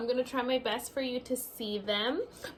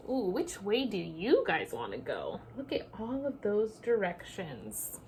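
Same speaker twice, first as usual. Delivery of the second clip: ordinary delivery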